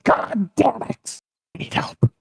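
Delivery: very slow, high mock outburst; hammed-up drawn-out exasperation; frustrated curse. North Korea losing, curses again.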